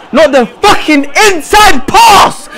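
slow, high, angry yell; drawn-out furious warning; exasperated shout. Real, not acted. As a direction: hammer each word at a player who won't listen. Repeats warning, now shouting.

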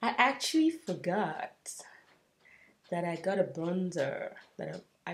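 ordinary talk

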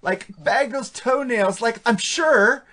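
put-on high, slow Valley Girl drawl; imitating a ditzy Valley Girl. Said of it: "doing all the freaking Valley Girl talk".

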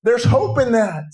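high-pitched, urgent revival sermon; impassioned preaching. Real, not acted. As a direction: preach with fervor, driving each phrase onward. Preaches at full force.

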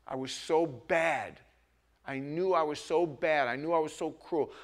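ordinary talk, nothing marked

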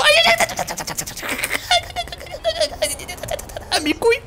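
high pained yelps. Acted.

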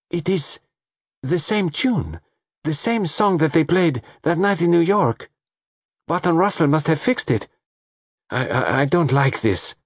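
high, agitated and uneasy; fearful rush of alarm; frightened. Acted. Lola frightened by the tune.

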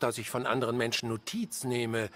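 ordinary talk

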